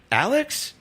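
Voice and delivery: high, tentative retold question; puzzled question. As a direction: ask it as doubt suddenly dawns. Retold sudden doubt about identity.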